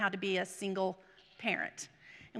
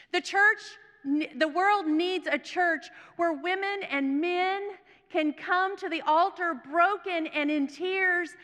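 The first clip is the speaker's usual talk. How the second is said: slow, raised, emphatic sermon voice; impassioned preaching; 5 semitones higher, 4 dB louder, slower than usual. Real, not acted. Preacher tells congregation what church the world needs, impassioned proclamation. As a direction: proclaim it phrase by phrase, slowly.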